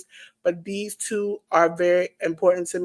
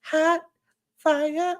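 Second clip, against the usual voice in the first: catchphrase delivery; 5 semitones higher, slower than usual. Pretend. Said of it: "We're gonna say".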